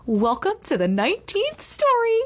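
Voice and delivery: high, drawn-out teacher's greeting; theatrical welcome. A narrator's reading. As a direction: welcome them grandly, stretching the last word. Teacher's uncanny chapter-ending welcome.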